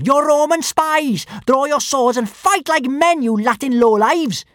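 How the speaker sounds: high, loud, furious accusation; little girl's yell. A narrator's reading. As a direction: hurl insults as a high-voiced challenge to fight. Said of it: "yelled the little girl".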